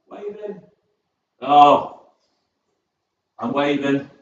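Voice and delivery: ordinary talk, nothing marked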